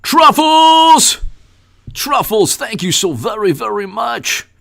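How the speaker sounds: squealed happy greeting; excited shout-out. Real, not acted. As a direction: call the name out in delight. Greeting a donor by name.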